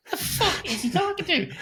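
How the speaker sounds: very high confused question; baffled outburst. Retold or imitated. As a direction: squeal in confusion. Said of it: "Da, da, da".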